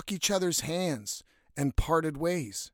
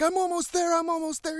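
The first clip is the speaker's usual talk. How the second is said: put-on straining kid; 12 semitones higher, faster than usual. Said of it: "He's like".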